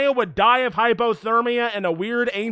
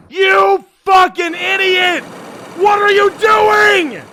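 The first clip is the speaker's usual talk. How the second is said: much higher, louder, slow outraged yelling; exasperated yell; 9 semitones higher, 8 dB louder, slower than usual. Real, not acted. Streamer yelling at the game in disbelief, loud exasperated yell. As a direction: bellow at the screen in disbelief.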